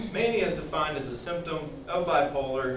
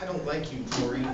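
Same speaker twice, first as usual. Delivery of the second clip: irritable; 2 semitones lower than usual. Shown as a demonstration. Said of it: "mood swings".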